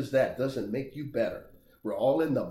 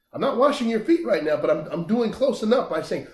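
excited preaching; 9 semitones higher, 7 dB louder than usual. Real, not acted. Fervent preaching burst.

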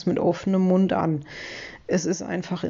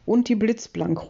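usual speaking voice

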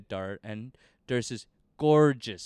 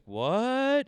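drawn-out "what", soaring very high and louder; stunned disbelief; 12 semitones higher, 5 dB louder than usual. Retold or imitated. Storyteller replaying his reaction to the brother's reasoning, stunned disbelief. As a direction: squeal the question as a mock-stunned reaction.

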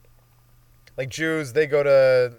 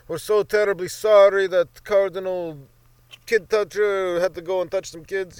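slow, solemn fake-sorry Pope voice, higher; a grave official apology, hammed up; mock papal apology; 5 semitones higher, 4 dB louder than usual. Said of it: "They're like".

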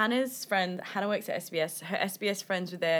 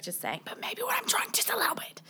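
quick breathy whisper, high and soft; whispered aside; 12 semitones higher, 6 dB quieter than usual. Real, not acted. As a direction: let the confession slip out as a whisper.

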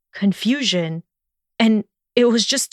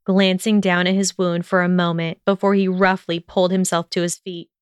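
ordinary delivery

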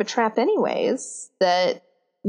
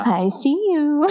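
playful cat voice; 6 semitones higher than usual. Pretend. Voicing cat chasing laser.

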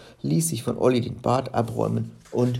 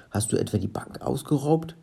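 ordinary delivery, nothing marked

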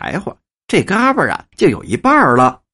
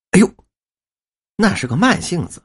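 ordinary talk, nothing marked

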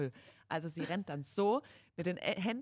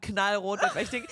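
ordinary talk, nothing marked

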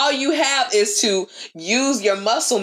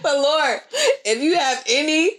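raised-pitch, earnest-sounding plea; mock pleading prayer; 5 semitones higher than usual. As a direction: beg the Lord for strength, half joking. Mock prayer for strength while pumping arms.

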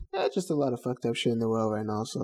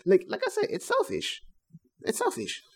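raised emphatic voice; 10 semitones higher than usual. Real, not acted. Heated point, repeated for emphasis.

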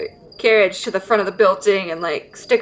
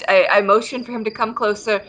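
ordinary talk

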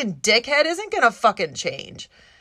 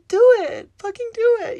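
much higher, insistent push; urging friend; 10 semitones higher, 3 dB louder than usual. Retold or imitated. Friend pushing her to go for it, high eager urging. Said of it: "She's just like".

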